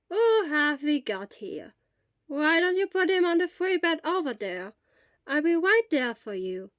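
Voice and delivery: put-on gently welcoming as a lightly done old nurse; kindly old nurse. Nurse character voiced.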